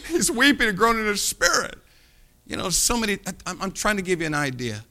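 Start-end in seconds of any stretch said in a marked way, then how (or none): 0.1-1.8 laughing. Real, not acted. Laughing through the line.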